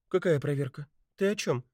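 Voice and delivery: high puzzled question, rising; surprised. A narrator's reading. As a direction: sound honestly caught off guard. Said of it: "удивилась Бетти".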